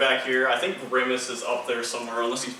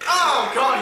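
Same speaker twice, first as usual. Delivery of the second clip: surprised; 11 semitones higher, 6 dB louder than usual. Real, not acted. Toy spits food everywhere.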